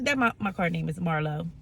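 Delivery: ordinary talk, nothing marked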